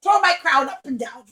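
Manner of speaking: loud demanding royal orders; bossy princess. Acted. Princess orders clown to juggle her crown, bossy. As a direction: command it carelessly. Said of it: "and she said".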